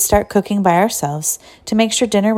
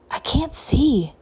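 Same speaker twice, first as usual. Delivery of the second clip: slow, high whisper; 7 semitones higher, 4 dB quieter, slower than usual. A narrator's reading. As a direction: breathe it as a secret. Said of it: "whispered Mona".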